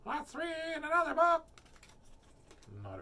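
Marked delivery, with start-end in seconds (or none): 0.0-1.5 high, loud, quick dummy voice; mock ventriloquist act. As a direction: squeak it out loudly like a puppet. Jokey put-on ventriloquist line.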